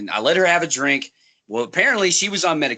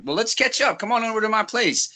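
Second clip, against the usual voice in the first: cheerful invite; 8 semitones higher than usual. Retold or imitated. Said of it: "and I was like".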